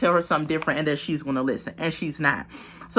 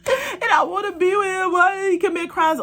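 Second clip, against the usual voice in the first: high, wailing mock sob, hammed-up; mock crying; 12 semitones higher than usual. Renika crying on the phone after he asks for bail, high hammed-up mock wail. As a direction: whine it like a fake cry, way up high. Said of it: "Renika starts crying like".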